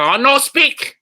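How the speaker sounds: high, loud mock broken English to a heckler; fake broken English. Comic replying to a heckler in mock broken English. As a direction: play dumb in put-on broken English. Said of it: "I go".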